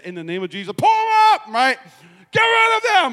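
high loud mock command; commanding rebuke. Pastor voices his wife rebuking the toxic plants, loud high command. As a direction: rebuke it like a prayer warrior. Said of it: "She said".

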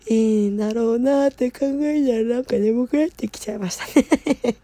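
talking through a yawn. Real, not acted.

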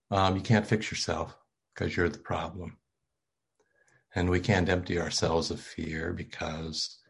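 ordinary talk

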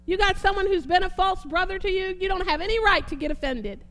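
strong, declamatory Bible reading; rolling, emphatic pulpit delivery; preaching scripture reading. Real, not acted. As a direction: proclaim each item of the list, leaning hard on key words.

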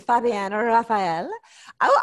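ordinary delivery

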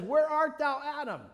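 God calling through the garden. Acted. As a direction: call out high and loud, searching for him.

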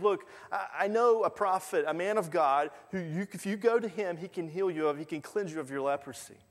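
put-on a servant girl pleading her good news; young maid girl. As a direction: voice lifted high and eager like a young girl. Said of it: "And she said".

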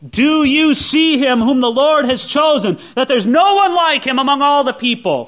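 sarcastic Samuel. Retold or imitated. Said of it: "Samuel said this sarcastically".

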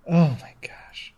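breathy whisper, slow and disbelieving; whispered disbelief. Real, not acted. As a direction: whisper it, stunned. Exasperated at the Wikipedia page.

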